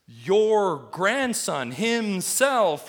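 Your grandson himself, slow, high and loud with himself hit hard; stress on himself. Shown as a demonstration. Preacher to church, stressing himself loud and slow. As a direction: lean hard on himself, very slowly. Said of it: "Where's the emphasis?".

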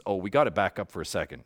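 ordinary talk

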